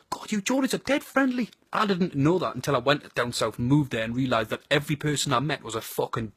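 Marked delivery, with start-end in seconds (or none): 0.1-1.5 higher-pitched, lightly imitated visitor; impressed Southerner. Southerner praising the Geordies, impressed and higher-pitched. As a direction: raise the pitch to mimic an outsider's praise. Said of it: "Southerners go to me all the time".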